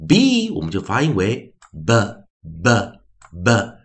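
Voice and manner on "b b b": b as a short "buh", not the letter name; slow, spaced b sounds, each "buh"; phonics sound. Shown as a demonstration. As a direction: say b three times as separate "buh" beats. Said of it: "用自然拼读的念法，phonics的念法".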